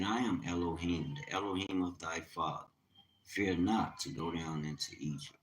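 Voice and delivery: quiet solemn scripture voice; scripture reading. Acted. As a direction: read the verse with reverence. Reads scripture aloud.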